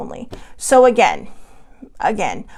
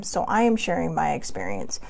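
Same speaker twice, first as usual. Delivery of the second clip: ordinary delivery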